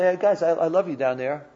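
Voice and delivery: ordinary delivery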